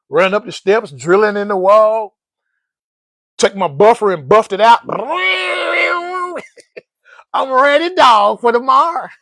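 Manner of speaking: loud, thrilled retelling; bubbling over, loud and eager; excited. Real, not acted. Recalling putting up the board, loud and excited. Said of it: "Boy, I was excited".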